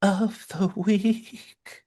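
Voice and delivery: very slow, soft unearthly tone; spirit voice. Pretend. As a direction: breathe it out like a spirit. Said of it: "Going to spirit voice there".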